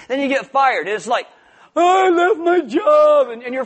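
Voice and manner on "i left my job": mock panic. Mock panic after being fired.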